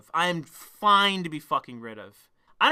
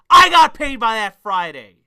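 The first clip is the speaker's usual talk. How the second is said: loud, drawn-out, raised-pitch declaration; emphatic boast; 4 semitones higher, 12 dB louder than usual. Real, not acted. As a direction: belt out the victory slowly and forcefully. Triumphant end of his angry story.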